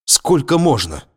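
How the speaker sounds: high exasperated outburst; flaring up. A narrator's reading. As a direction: snap it out, fed up. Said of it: "вспылил Виктор".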